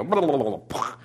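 babbling sputter that winds down and pops; imitated system breakdown. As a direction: imitate a machine crashing with a lip burble. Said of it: "they break down, and they go".

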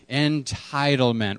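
Entitlement said slowly for the room to repeat; slow call and response. Shown as a demonstration. Pastor leading the church in repeating, slow and clear. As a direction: lead the congregation through Entitlement, slowly. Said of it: "Everyone say it with me".

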